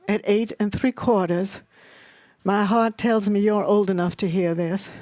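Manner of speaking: ordinary delivery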